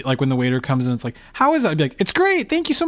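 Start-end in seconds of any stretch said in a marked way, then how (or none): 1.3-1.7 polite waiter. Retold or imitated. Voicing a waiter checking in. 2.0-2.9 high, sugary people-pleasing chirp; high, eager-to-please customer; overly polite. Retold or imitated. Overly nice reply to the waiter.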